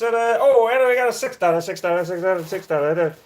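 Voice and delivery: fast, loud auction chant; auctioneer. Pretend. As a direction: rattle off bids like an auctioneer. Mock auction of the dog.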